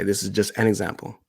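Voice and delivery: ordinary talk, nothing marked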